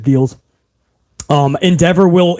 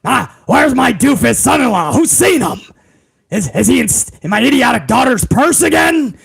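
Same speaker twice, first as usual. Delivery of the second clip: hammed-up irate boss impression; Vince McMahon. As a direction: bellow for the son-in-law like an overbearing boss. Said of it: "Vince McMahon was like".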